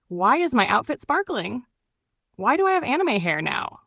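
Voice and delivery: plain reading voice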